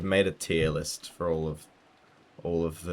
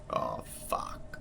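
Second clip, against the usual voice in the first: whispered curse; 10 semitones lower, 6 dB quieter than usual. Real, not acted. Shocked at discovery.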